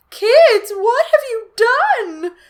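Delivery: high, very loud, slow cry; mother's horrified cry to the kids; cried. Acted. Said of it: "Missus Brewer cried".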